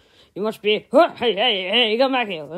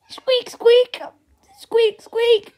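higher squeaks, a bit louder; squirrel squeak effect; 13 semitones higher, 5 dB louder, slower than usual. Pretend. Voicing the squirrel's squeaks himself, high and louder. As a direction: squeal like a startled rodent. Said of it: "doing these sound effects all on my own".

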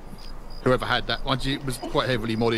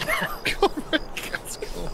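very slow, much higher, drawn-out mock Russian; mock Russian title; 4 dB quieter, much slower than usual. Gamer proudly announcing his new mock Russian name to the squad. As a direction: stretch it out like a grand mock comrade. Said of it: "That's what I'm being called".

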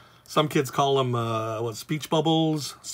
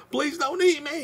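high squeaky plea of a tiny cartoon victim; hammed-up pleading in a very high little voice; mock cry of anguish. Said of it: "he's the poor little guy".

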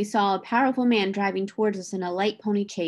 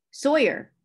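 stern call of the name, higher and a little louder; sharp reprimand by name; stern cry; 5 semitones higher, 4 dB louder than usual. A narrator's reading. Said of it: "he cried in a stern voice".

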